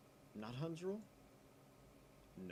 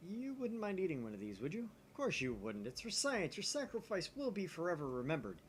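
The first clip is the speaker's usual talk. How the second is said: a hint of a grand, higher Okabe pushing Daru; lightly voiced mad scientist; 7 semitones higher than usual. Player voicing Okabe urging Daru to eat the blob, lightly hammed. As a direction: coax him with mock grandeur. Reads Okabe's dialogue aloud.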